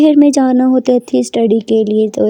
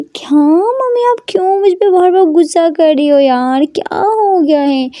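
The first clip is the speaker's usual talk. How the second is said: whiny daughter; 6 semitones higher than usual. Acted. Daughter protesting, high whine.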